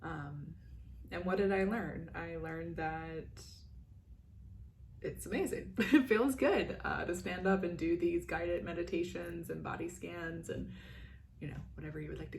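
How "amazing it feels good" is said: laugh, then burst out higher and louder; joyful laughing outburst; excited. Real, not acted. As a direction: gush with real delight. Overjoyed about her meditations.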